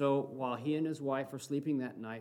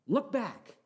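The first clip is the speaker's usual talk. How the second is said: preaching at full force; 7 semitones higher, slower than usual. Acted. Preacher exhorting brothers and sisters, fervent preaching. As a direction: rouse the church to look to Jesus. Leads a preaching call to congregation.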